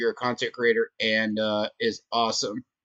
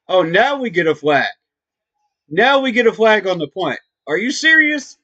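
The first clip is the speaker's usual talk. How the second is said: exasperated; 5 semitones higher, 5 dB louder than usual. Real, not acted. Flag finally thrown.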